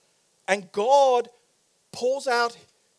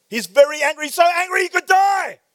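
mock-angry emphasis; 9 semitones higher, 11 dB louder than usual. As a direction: build to a high, loud shout. Preacher hammers home Jonah's fury.

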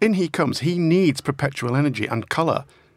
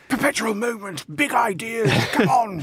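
high-pitched blustering politician impression; rally them in a high, put-on voice; Boris; 9 semitones higher than usual. Guest mimicking the prime minister's big ideas, blustering impression. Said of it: "that's my Boris impression".

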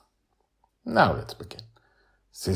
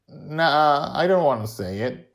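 put-on reluctant, slightly raised boy's voice; sheepish; 8 semitones higher, 5 dB louder than usual. A narrator's reading. Boy refuses to say the curse, sheepish. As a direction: back out of it sheepishly. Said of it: "he says sheepishly".

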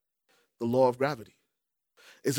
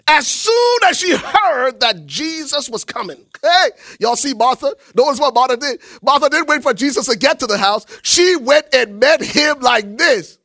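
rising preaching; 7 semitones higher, 6 dB louder than usual. Acted. Preacher builds on Martha story.

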